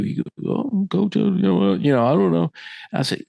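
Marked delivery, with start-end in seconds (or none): 0.5-2.5 put-on higher-pitched, hesitant kid fumbling an excuse; stammering young player. Player fumbling an answer to his coach, higher and hesitant. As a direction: imitate a kid stuck for an answer.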